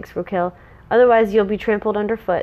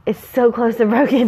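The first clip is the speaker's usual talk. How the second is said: amused, laughing through the words; 3 semitones higher, 4 dB louder than usual. Real, not acted. Reader cracks up over misreading brokered as broken, laughing.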